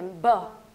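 ब as a low "buh" popped from closed lips; lips-together B. Shown as a demonstration. Teacher showing lip position, popped B sound. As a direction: press lips, then release ब. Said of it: "दोनों lips को आपने मिलाना है".

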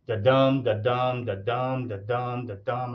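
sing-song da dum, stress on dum; da-dum rhythm. Shown as a demonstration. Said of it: "is basically how they sort of say it".

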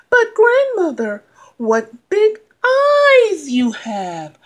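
put-on high, slow, wondering girl; curious little girl. Girl questions the wolf.

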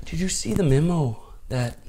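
high-pitched attempt at a Canadian accent; Canadian teacher accent. Canadian teacher questioning a pupil about a memo, Canadian accent. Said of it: "I don't know if this accent's terrible".

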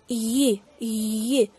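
Y with the tongue pressed up to the palate; English Y. Shown as a demonstration. Teacher showing how to shape the English Y. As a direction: press the whole tongue to the roof for Y. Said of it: "Dejamos la lengua como tocando".

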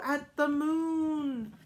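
usual speaking voice

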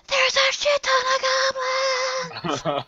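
high urgent hushed shout; whisper yelling. Acted. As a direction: yell it without being heard. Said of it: "Kinda like, kinda like whisper yelling".

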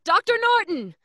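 loud high call of his name. Acted. Doctor shouting his name back to sheriff, loud. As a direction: announce yourself loudly.

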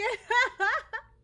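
high, loud squealing laugh; real burst of laughter; laughing cry. Real, not acted. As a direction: break into a wailing laugh. Laughing after quote.

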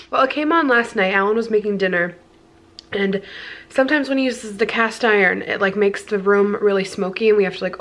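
ordinary talk, nothing marked

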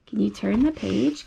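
plain reading voice

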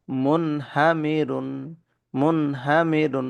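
মুনহামিরুন slow, with the nun clear before the h; slow tajweed model; slow clear recitation. Shown as a demonstration. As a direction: stretch মুনহামিরুন out slowly for students. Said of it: "নুন সাকিনের পরে হা আসছে।".

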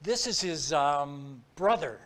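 slow, halting retold phone reply; imitating a hesitant voice on the line. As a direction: stall between phrases, as if unsure of the cover story. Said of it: "very hesitantly said".

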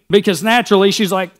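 ordinary talk, nothing marked

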